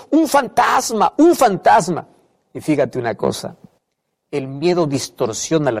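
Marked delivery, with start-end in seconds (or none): 0.1-2.1 frightened cry. Retold or imitated. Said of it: "empiezan a gritar diciendo".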